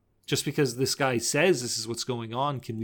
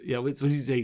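ordinary talk, nothing marked

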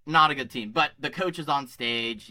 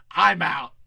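mock yell, a bit louder; retold scream; 3 semitones higher, 6 dB louder than usual. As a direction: blurt it out in defiance at the coach. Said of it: "I screamed".

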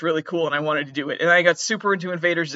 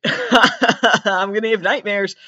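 louder and higher through laughter; laughing; 4 semitones higher, 4 dB louder, much slower than usual. Real, not acted. Laughing at his own creepy whisper bit.

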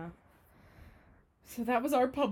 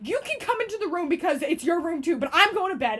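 higher, louder worked-up voice; retold huffy outburst; 7 semitones higher, 4 dB louder than usual. Girl to roommate before bed, retold huffy outburst. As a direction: lay down the rule, still mock-angry.

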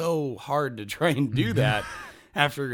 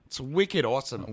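lightly voiced Boston slang; Boston accent. Shown as a demonstration. Host showing off his Boston accent. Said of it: "You wanna do Boston?".

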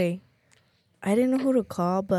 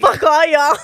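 higher, louder mock outrage; loud laughing outburst; 7 semitones higher, 11 dB louder than usual. Real, not acted. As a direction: yell it then laugh. Nobody answering the phone.

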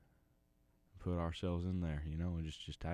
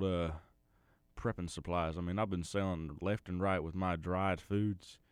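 loud, folksy backwoods cousin; 8 dB louder than usual. Acted. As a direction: holler it like a bayou prepper. Daryl the cousin keeps talking.

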